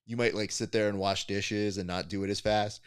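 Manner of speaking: normal talking voice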